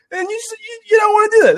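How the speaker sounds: squeaky high-pitched aside; high squeal. Real, not acted. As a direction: jump way up into a squeak. High squeal after the quote.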